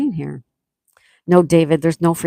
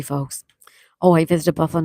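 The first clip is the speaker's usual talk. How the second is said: normal talking voice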